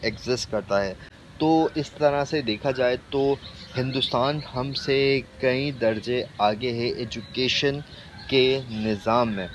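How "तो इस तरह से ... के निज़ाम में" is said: ordinary delivery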